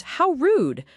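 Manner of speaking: high indignant scream. A narrator's reading. Offended captive screaming at the guard, high outraged cry. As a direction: shriek it out in outrage. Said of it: "A voice screams".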